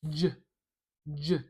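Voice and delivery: "J" as a short "juh"; J with schwa. Shown as a demonstration. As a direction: clip out "J" with a weak, neutral vowel. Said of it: "put a schwa here".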